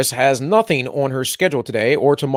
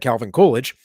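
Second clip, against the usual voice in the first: ordinary talk, nothing marked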